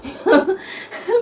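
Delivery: scornful laugh. Real, not acted. Laughing at mother-in-law.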